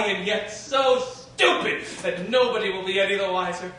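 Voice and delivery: usual speaking voice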